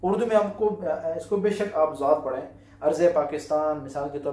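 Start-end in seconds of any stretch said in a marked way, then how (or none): none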